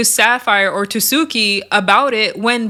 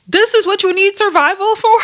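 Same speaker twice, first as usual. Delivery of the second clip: much higher, louder mocking question; shading mockery; 11 semitones higher, 5 dB louder than usual. Mariah turning to Big Gretch, mocking disbelief. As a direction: throw shade at her tiny friend. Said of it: "She turned around at Big Gretch like".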